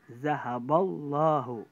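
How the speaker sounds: zahaballahu with a thick, heavy lam, slow and loud; heavy tafkhim lam. Shown as a demonstration. Teacher demonstrating the heavy lam to students, slow and loud. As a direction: boom out zahaballahu with a dark, full lam. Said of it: "Itu tebal".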